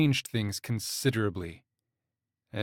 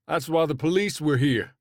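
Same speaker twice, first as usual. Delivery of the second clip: calling after; 4 semitones higher, 4 dB louder than usual. A narrator's reading. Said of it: "Landon called after him".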